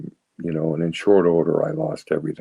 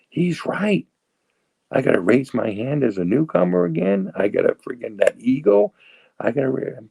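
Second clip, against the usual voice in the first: dismayed realization; 8 semitones higher than usual. Retold or imitated. Acts out his thought after rebuke.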